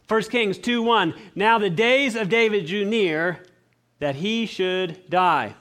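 slow, deliberate Bible reading; scripture reading. Acted. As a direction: read each word out weightily. Reads scripture slowly.

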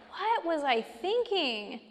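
mock exasperation at your own thinking; self-reproach. Acted self-questioning after a mistake.

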